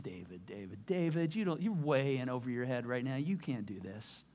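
low, soft, patronizing king; condescending king. Retold or imitated. King Saul brushing off David, low and soft. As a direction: talk down to the boy, low and quiet. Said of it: "He's like".

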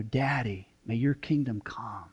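soft, low and slow; tender prayer. Shown as a demonstration. Pastor showing the church how to pray, tender and slow. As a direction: pray it as a child speaks to a loving dad. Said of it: "a grace that you're experiencing when you say".

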